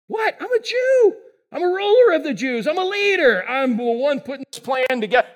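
high, indignant squeaky protest. Retold or imitated. Nicodemus protesting his status to Jesus, high indignant voice. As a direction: protest like a pompous official caught off guard.

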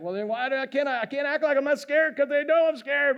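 high, indignant mock complaint; whiny protest. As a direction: push back like a nervous rookie rider. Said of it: "And I'm like".

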